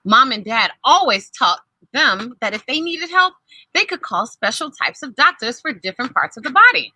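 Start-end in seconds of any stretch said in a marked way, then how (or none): none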